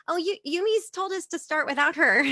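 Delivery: high, loud, breezy social voice; exaggerated bright small talk; fake cheeriness. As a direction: put on a friendly front. Acting fake-friendly greeting to him.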